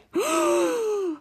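long high drawn-in gasp; drawn-out awed gasp. Acted. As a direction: gasp in amazed wonder. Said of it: "did it again".

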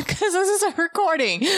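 high-pitched playful retort; mock indignation. Reader rebutting co-host's plea to hurry, mock-indignant.

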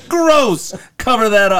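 high, loud laughing disgust; high disgusted squeal. Retold or imitated. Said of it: "Tom's like".

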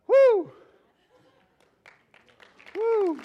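high, loud shout of praise; joyful whoop. Real, not acted. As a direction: let out a big excited whoop. Worked up after the sermon.

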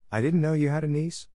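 lightly voiced, curious rising question; a hint of puzzled curiosity. A narrator's reading. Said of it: "I asked curiously".

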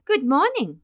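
high, lilting pleasant greeting. A narrator's reading. Nurse at the desk greets Mister Button, pleasant. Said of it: "looking up at him pleasantly".

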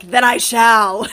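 pompous knight accepting his quest, half laughing; mock medieval knight. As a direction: ham up a gallant knight through a laugh. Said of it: "Why is [name] all of a sudden a medieval knight".

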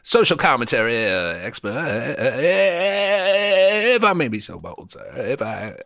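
a hint of a stuffy Southern gentleman stammering politely; Southern gentleman accent. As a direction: play a fussy old Southern gentleman, hesitant and formal. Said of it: "He was a Southern".

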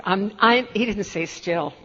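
normal talking voice

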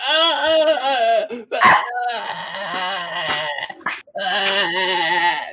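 high, long drawn-out fake crying; mock wail. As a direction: wail in hammed-up dry sobs. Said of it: "no matter how much she cries".

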